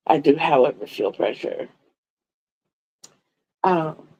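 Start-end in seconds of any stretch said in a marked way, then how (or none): 0.9-1.7 breathy whispered aside. Real, not acted. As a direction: drop to a whisper for effect. Dry aside about her pressure.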